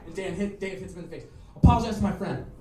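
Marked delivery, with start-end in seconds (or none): 1.5-2.5 louder acted order; forceful demand. Dave punches the skinhead and demands an apology, forceful demand. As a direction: act out repeating the order, firmer.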